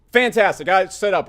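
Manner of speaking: usual speaking voice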